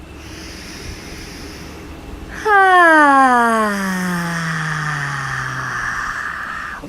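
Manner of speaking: very long low "ah" release; long "ah" sigh. Shown as a demonstration. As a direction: let all the energy out on a long ah. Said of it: "Third one".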